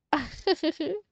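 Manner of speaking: little rippling laugh; short giggle. Real, not acted. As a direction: giggle briefly at your own fumble. Teacher laughs at own fumble.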